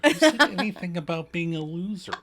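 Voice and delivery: mock indignant. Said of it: "turn on her like Jerry Maguire".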